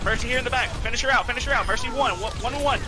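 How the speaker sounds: excited callout. Real, not acted. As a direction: push the team hard, urgently. Urgent team callouts in fight.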